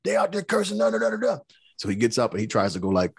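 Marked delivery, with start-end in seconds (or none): 0.0-1.4 imitating a kid's furious rant, much higher; mock angry student outburst. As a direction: play the student spazzing, pitched way up and fast. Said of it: "he f-, spazzed".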